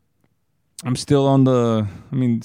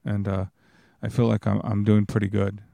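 ordinary talk

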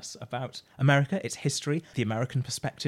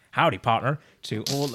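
louder, badly faked American cowboy; attempted American accent; 2 semitones higher, 5 dB louder than usual. Shown as a demonstration. Second go at greeting American listeners, attempted American accent. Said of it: "I can't do an American accent".

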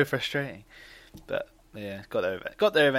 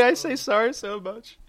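high put-on voice; 9 semitones higher than usual.

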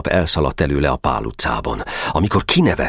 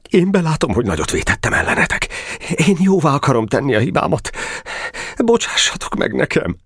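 high, hurried choked-up pleading; 9 semitones higher, faster than usual. A narrator's reading. Geréb admitting his fault to Boka, choked=. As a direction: beg forgiveness, voice raised and catching. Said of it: "mondta elfulladó hangon".

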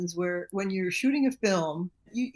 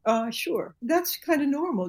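usual speaking voice

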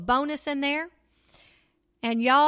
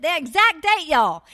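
higher, louder exclaim; excited exclaim; 4 semitones higher, 7 dB louder than usual. Real, not acted. Preacher revealing the date to her listeners, excited. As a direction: land the miracle with a thrill. Punchline of miracle story.

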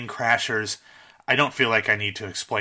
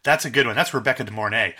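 ordinary talk, nothing marked

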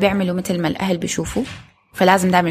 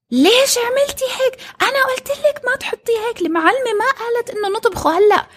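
put-on much higher, whiny child screaming at the nanny; spoiled boy yelling. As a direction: shriek it like a bratty kid scolding. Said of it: "ويصرخ".

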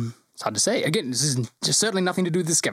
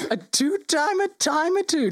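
much higher, slow, fussy official; the GM voicing the Lord Mayor, high and fussy; 13 semitones higher, slower than usual. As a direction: pitch it way up like a flustered dignitary.

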